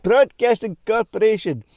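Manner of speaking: loud, high-pitched broadcast announcement; big pretend broadcaster call; mock station announcer. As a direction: announce the station with playful grandeur.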